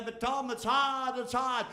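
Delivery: imitating a moaner; whiny complainer. Speaker mocking people who complain, whiny. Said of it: "I hear people say".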